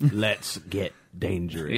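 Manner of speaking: slow, low heroic line; cartoon hero catchphrase. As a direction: deliver it like Darkwing. Darkwing Duck catchphrase.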